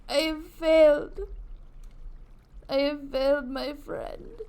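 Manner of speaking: theatrical wail dying away; mock despair. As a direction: lament your failure melodramatically. Mock grief after failed rescue.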